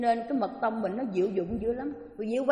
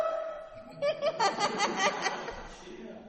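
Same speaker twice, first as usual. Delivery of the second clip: big laugh; 10 semitones higher, 5 dB quieter than usual. Real, not acted. Laughter after the husband's line.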